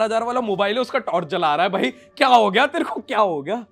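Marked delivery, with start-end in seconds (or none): 1.7-3.7 alarmed roommate. Retold or imitated. Roommate shaking the screaming friend.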